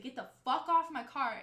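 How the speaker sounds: retold aggressive demand. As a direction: act out warning someone off your car. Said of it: "I got very aggressive".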